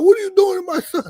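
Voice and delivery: high, loud mock protest; retold outraged father. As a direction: imitate an alarmed parent yelling. Retold father confronting the coach.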